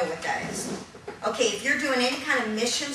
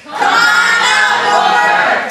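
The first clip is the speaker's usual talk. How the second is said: "Come on out, Wolfer" hollered much higher and louder; louder shout; 12 semitones higher, 14 dB louder, much slower than usual. Shown as a demonstration. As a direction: call him out even bigger this time. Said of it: "Let's try it a little bit louder".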